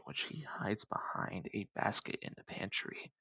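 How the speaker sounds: hushed, breathy secret aside; whispered aside. Real, not acted. Telling viewers where dad hides whiskey, whispered. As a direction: let them in on a secret in a whisper.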